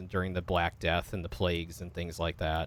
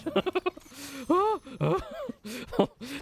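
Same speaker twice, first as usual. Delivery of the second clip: high-pitched laugh of relief; high tense laugh. Real, not acted. Gamer after a very tense fight, high tense laugh. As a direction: let out a long, high, giddy laugh.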